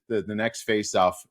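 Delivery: ordinary talk, nothing marked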